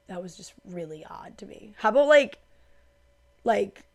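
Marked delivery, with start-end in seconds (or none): 0.1-1.7 whisper. Real, not acted. Aside to viewers.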